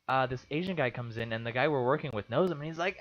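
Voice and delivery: ordinary delivery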